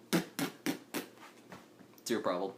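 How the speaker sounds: very quiet, slow confrontation; low challenge. Acted. Game master acts out facing the offended aristocrat, hushed challenge. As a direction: challenge them slowly under your breath.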